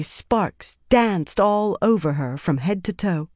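ordinary delivery

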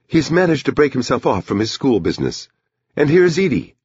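high-pitched woman's voice; Bonnie, the wife. Acted. Wife remarks on her husband finally arriving, high woman's voice.

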